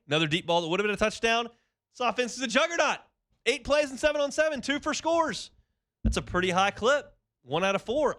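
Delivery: high-pitched tongue-in-cheek sports hype; mock hype. Radio host jokingly overhyping a practice stat, mock excited. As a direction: play up the excitement, half joking.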